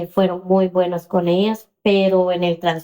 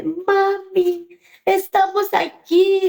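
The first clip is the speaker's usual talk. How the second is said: high, slow plaintive voice; tearful child; 11 semitones higher, much slower than usual. Retold or imitated. Crying daughter telling her mother where she is, plaintive. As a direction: imitate a crying child on the phone. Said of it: "porque su hija está llorando".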